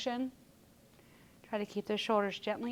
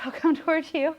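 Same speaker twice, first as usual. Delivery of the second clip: laughing; 6 semitones higher, 4 dB louder than usual. Real, not acted. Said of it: "I know it's funny".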